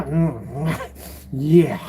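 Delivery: low grunt and a yeah, played for laughs; lightly voiced screen-cowboy impression; Clint Eastwood. Moviegoer coming out in pain, mock Eastwood. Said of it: "like you doing Clint Eastwood impersonation".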